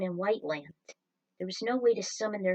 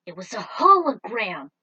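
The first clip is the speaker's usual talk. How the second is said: startled realisation; 11 dB louder than usual. A narrator's reading. Realises the machine was fake.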